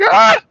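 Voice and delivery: loud, high-pitched "ah" scream; mock panicked scream. Imitating the French as Russians come, mock high scream. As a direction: shriek in hammed-up alarm.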